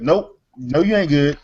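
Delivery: ordinary talk, nothing marked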